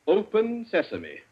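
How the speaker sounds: slow, easy, friendly tone; conversational. Acted. Wimsey coaxing the voice lock, conversational. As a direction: coax it like chatting. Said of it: "If I can only be conversational".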